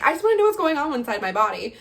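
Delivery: mildly acted exasperated plea; retold exasperation. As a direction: blurt it out, desperate for an answer. Voicing desperate wish for an answer.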